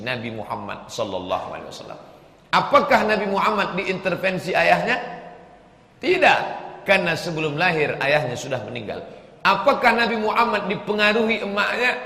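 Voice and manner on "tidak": sharp, high, loud no; emphatic no. Acted. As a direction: slam the answer down hard. Answers his own rhetorical question.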